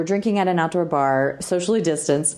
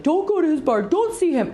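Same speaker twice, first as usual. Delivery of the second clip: higher-voiced urgent friend; Brent warning her; 11 semitones higher than usual. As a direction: imitate the worried friend urging her to stay away, pitched up. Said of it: "He's like".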